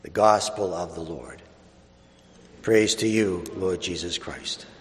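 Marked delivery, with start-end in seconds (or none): none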